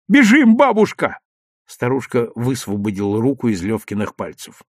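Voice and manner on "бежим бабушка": high, loud urging; eager boy's exclamation; urgent calling. A narrator's reading. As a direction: hurry granny along with a cry. Boy urges granny to run.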